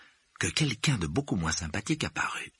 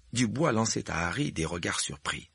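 plain reading voice

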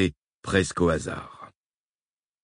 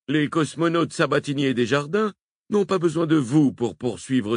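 officier persuasif; 7 semitones higher than usual. A narrator's reading. Heyman/Hillman persuading Brown.

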